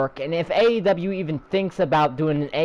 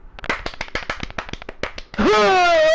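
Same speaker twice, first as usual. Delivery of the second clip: exasperated sigh; 10 semitones higher than usual. Real, not acted.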